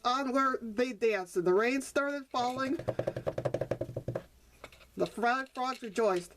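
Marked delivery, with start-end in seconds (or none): none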